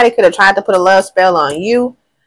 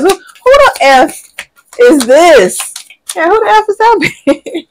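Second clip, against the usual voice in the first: much higher, louder hammed-up demand; mock jealous outrage; 10 semitones higher, 4 dB louder than usual. Reader acting the jealous ex spotting a rival, mock outrage. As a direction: play the nosy ex, outraged.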